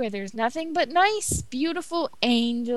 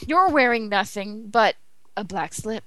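normal talking voice

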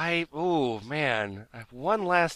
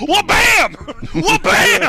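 high, loud wrestling-announcer yell; hype shout; 11 dB louder, slower than usual. Acted. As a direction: shout it like a ringside call.

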